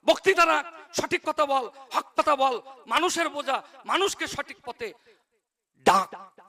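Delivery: high, insistent scolding orders; imitated yelling. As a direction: imitate a father shouting a preacher awake. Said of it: "চিল্লে চিল্লে আমাকে বলছে".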